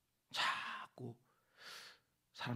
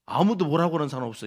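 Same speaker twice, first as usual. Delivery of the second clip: emphatic; 12 dB louder, faster than usual. Acted. Emphatic aside.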